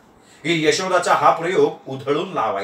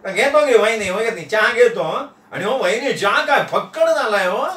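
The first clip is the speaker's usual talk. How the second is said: high-pitched chatty guest with a chuckle; cheerful, higher character voice; jovial Nana; 3 semitones higher than usual. Acted. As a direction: praise it gleefully in a high voice. Voices Nana praising tea.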